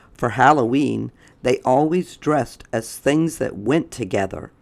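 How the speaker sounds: usual speaking voice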